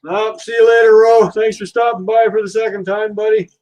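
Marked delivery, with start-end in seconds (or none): none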